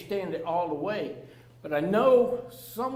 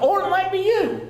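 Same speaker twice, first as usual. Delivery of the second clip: or it might be YOU, pitched up high; emphatic; 11 semitones higher, 6 dB louder than usual. Real, not acted. Speaker warning the audience it could be them, emphatic. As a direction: point straight at the listener on "you".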